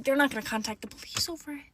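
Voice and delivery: ordinary delivery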